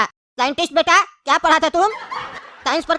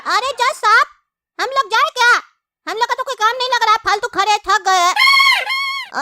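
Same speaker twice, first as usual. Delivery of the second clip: high, squeaky put-on witness; a pipsqueak whining to the judge; high cartoon whine, dragging the last words slow; whiny witness voice; 8 semitones higher, slower than usual.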